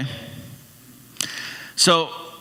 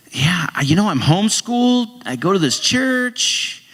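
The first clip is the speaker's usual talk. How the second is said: put-on higher, innocent kid; kid's voice; 6 semitones higher, slower than usual. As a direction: play the homeschooled boy, high and earnest. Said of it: "And he's like".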